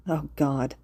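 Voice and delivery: ordinary delivery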